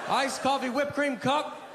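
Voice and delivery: high, hammed-up order announcement; mock call-out. Customer orders in his own odd words, mock calling out. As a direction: pitch it way up as if ordering proudly.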